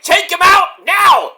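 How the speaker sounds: high, loud, slowed-down command; yelled order. Retold or imitated. Moxley yelling at William Yuta, mock angry shout. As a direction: yell the order, hammering each word. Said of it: "like, yelling at William Yuta".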